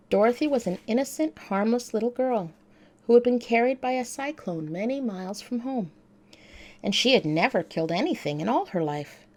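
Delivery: ordinary delivery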